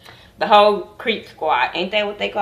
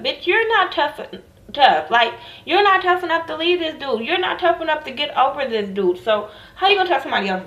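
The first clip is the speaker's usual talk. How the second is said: pitched up, incredulous rant; heated rant; 7 semitones higher than usual. Real, not acted. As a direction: let her have it, voice climbing high. Ranting at Tara, pitch high.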